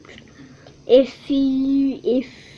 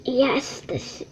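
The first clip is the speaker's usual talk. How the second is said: plain reading voice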